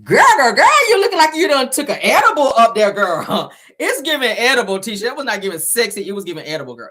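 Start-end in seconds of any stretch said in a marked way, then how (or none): 0.0-3.5 high, louder sassy roast; loud teasing call-out. Acted. Vlogger roasting Tisha's acting, loud and sassy. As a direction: get on her case, loud and laughing.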